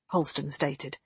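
usual speaking voice

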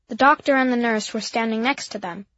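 plain reading voice

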